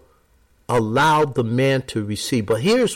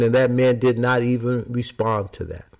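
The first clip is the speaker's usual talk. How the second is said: ordinary talk, nothing marked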